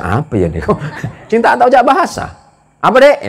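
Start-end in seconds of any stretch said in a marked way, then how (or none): 2.8-3.2 high, loud market shout; a loud Madurese watermelon seller answering a student; Madurese seller yelling. Retold or imitated. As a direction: call back like a brusque Madurese vendor. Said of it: "Orangnya kalau ngomong tuh teriak".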